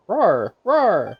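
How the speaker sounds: eager little saurian roaring; excited creature roars. Acted. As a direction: roar eagerly like a small dinosaur. Saurian getting an idea.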